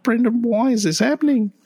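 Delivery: very high-pitched, pleading wail; mock despair. Vet asks his co-host why patients won't wake, mock despair. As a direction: play up helpless panic in a squeaky high voice.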